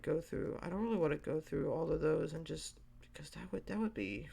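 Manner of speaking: usual speaking voice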